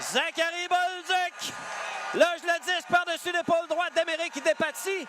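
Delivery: excited goal call. Real, not acted. (cri de but sur échappée)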